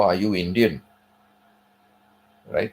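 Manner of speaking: ordinary delivery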